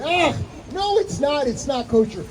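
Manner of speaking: high, protesting crowd of students; a room of students shouting it down in disbelief; crowd objecting. Retold or imitated. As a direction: protest as the whole room at once. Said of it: "they all say".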